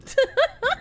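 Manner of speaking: high giggle. Real, not acted. Giggle after donation.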